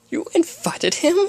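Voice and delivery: high, slow, scandalized question; gasping. Acted. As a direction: gasp it in high-pitched disbelief. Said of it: "Rarity gasped".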